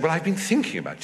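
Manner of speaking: high, quick aristocratic lady; Duchess of Devonshire. Retold or imitated. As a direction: play a brisk society duchess. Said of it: "she said".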